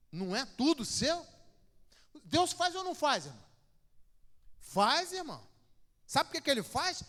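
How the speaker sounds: loud, high preaching climax; a preacher shouting at his church, loud and high; fiery preaching. Acted. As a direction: thunder it at the congregation. Preaching at full force.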